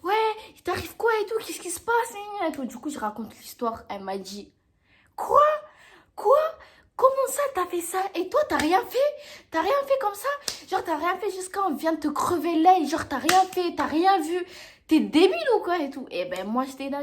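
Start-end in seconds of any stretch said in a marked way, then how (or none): 0.0-2.6 shocked mother. Retold or imitated. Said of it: "Elle était choquée". 5.1-16.1 high, loud incredulous scolding; the person scolding her daughter for not defending herself; outraged scolding. Retold or imitated.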